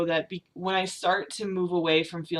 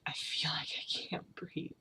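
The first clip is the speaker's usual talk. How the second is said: slow breathless whisper; 13 dB quieter, slower than usual. Real, not acted. Telling viewers she feels choked, whispered. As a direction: barely breathe the words out.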